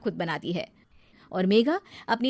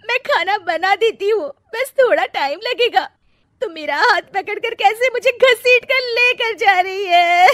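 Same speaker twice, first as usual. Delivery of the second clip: fake crying; 11 semitones higher, 9 dB louder than usual. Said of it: "रोने का नाटक करने लगती है".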